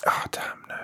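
breathy whisper aside. Real, not acted. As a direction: whisper it to yourself. Sudden realization aside.

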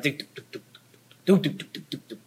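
a dying engine, duk duk duk, clipped and breathless at first; imitated engine sputter. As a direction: sputter out like a car running dry. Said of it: "the sputtering started".

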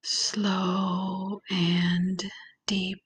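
calm, unhurried visualization voice with long pauses; guided meditation. Acted. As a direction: ease the listener gently into relaxation, lingering on key words.

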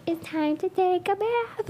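put-on high, soft little-kid voice; baby voice. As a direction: imitate a toddler's baby talk. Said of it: "in this, like, baby voice".

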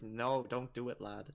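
soft mock-Scottish imitation; Scottish accent. Friend stopping her on a clock tower, mock Scottish accent. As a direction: put on a hint of weird Scottish. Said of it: "in her weird fucking Scottish accent".

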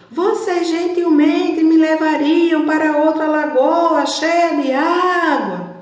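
high, loud, slow pleading call. Acted. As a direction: call up to the sky for help. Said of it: "a tartaruga os chamou".

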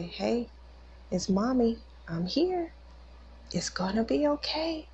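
put-on high, tender voice of a mother soothing her child; reassuring mommy. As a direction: retell it in a high, gentle coo. Mother reassuring injured son, retold.

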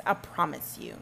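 usual speaking voice

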